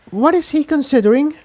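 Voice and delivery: high, puzzled mare voice; a curious young pony asking; puzzled Tiptoe. Acted. Tiptoe asks the unicorns what is planned, puzzled.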